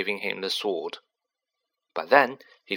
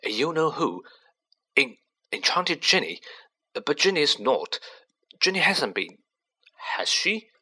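higher, stammering disbelief; rising panic of a shocked father; stunned repetition; 6 semitones higher than usual. Acted.

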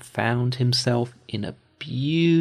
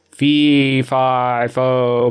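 booming, louder ogre bellow; terrible giant voice; 6 dB louder, slower than usual. Acted. Giant storming in smelling an Englishman, loud terrible bellow. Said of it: "he cried in a terrible voice".

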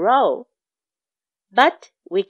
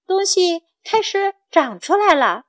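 ordinary delivery

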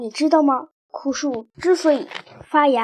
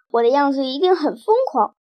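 plain reading voice

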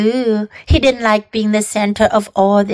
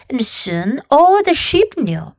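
usual speaking voice

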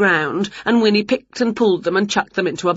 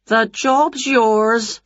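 slow jolly lady; 4 semitones higher, much slower than usual. Acted. Dinner lady hires Wilbur.

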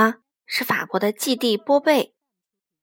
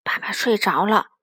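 ordinary delivery, nothing marked

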